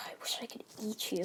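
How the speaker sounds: hungry whisper. Retold or imitated. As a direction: mutter it so he can't hear. Deku muttering hungrily.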